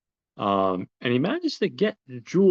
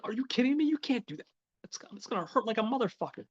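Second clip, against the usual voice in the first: high-pitched, acted girlfriend voice; incredulous protest; 12 semitones higher, 7 dB quieter, faster than usual. Girlfriend balks at having her tongue ring pulled, incredulous protest. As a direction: protest in a much higher voice, imitating her.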